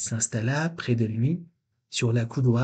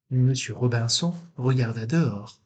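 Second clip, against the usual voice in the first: ordinary delivery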